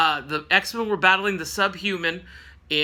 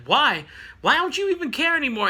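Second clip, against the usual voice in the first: mock outburst; 8 semitones higher than usual. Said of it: "Angel starts going off on Jean".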